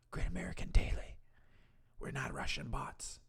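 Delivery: fast whispered aside; whispering. Real, not acted. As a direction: whisper it quickly. Whispered channel name.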